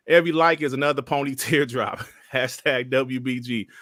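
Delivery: usual speaking voice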